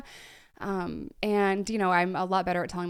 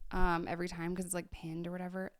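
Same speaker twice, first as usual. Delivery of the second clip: ordinary delivery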